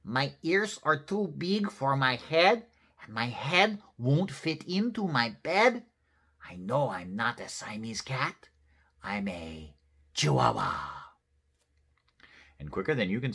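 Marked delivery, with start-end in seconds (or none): 0.0-11.0 hammed-up Spanish accent. A narrator's reading. Kitty boy claims to be Chihuahua, Spanish accent. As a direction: put on your very best Spanish accent. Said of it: "using his very best Spanish accent".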